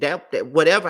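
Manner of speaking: ordinary talk, nothing marked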